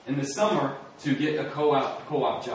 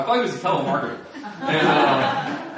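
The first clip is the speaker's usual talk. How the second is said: laughing; 5 dB louder than usual. Real, not acted. Laughing at his own stereotype.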